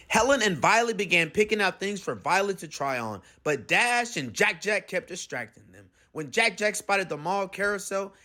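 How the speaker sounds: plain reading voice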